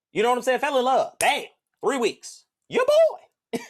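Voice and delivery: high, loud hyped boasting; loud, high, hyped brag; excited. Real, not acted. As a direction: hype it up. Boasting about falling fast.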